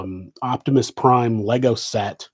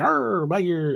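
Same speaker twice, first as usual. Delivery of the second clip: higher-pitched Irish attempt that turns pirate; failed Irish accent; 6 semitones higher, slower than usual. Pretending to be Irish, pirate-like bad Irish accent. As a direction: try Irish and land on pirate. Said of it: "I just sounded like a pirate".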